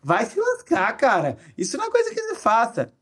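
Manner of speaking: joking insult out of pure joy at a gift; happy mock outrage. Shown as a demonstration. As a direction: squeal it like a delighted friend. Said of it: "pela minha entonação de voz".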